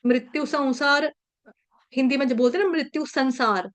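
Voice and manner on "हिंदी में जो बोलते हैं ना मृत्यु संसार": ordinary delivery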